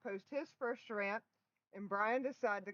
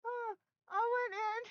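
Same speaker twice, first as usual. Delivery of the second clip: laughing; 12 semitones higher than usual. Real, not acted. Laughing at husband's rant video.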